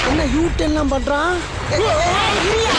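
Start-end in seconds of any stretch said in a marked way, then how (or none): 1.8-2.7 alarmed yelling. Acted. Panicked shouts at reckless driving.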